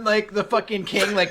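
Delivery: ordinary talk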